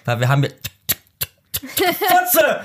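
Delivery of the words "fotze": mock Tourette yelp, sudden and high; Tourette outburst. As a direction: blurt the swear out in a high mock tic. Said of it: "manchmal dann Tourette".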